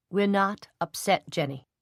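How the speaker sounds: ordinary delivery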